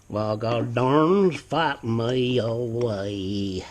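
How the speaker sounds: slow, drawn-out mock New York accent; lightly done New York accent, said slowly. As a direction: put on a hammy New York accent for a joke. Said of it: "That was my New York accent".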